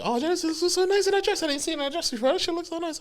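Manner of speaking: very high, squeaky mock gush; high-pitched gushing. Groom complimenting Jenny in her dress, high-pitched gush. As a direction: gush in a tiny high voice, holding back tears.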